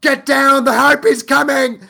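loud, high mock English shout; English-accent battle cry. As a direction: yell a warning in a hammed-up English accent. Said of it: "They'll be in a position where they're fighting".

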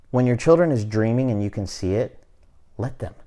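usual speaking voice

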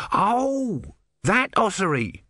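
high, drawn-out "oh" of recall; sudden recognition. A narrator's reading. Tortoise god finally remembering a prophet, high dawning recognition. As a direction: let the penny drop, pitched high.

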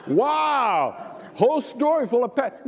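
high, drawn-out wow, laughing at it; hammed-up marvel at a silly book; mock amazement. Mock reaction to book title.

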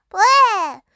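loud retching "bleh"; gagging. Acted. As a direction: gag it out as if throwing up. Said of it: "Y N gagged".

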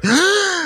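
sharp high gasp, as if out of air; a speaker's gasp between names; awkward gasp for breath. Shown as a demonstration. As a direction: suck in one quick breath mid-speech. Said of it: "the awkward, like, catching his breath?".

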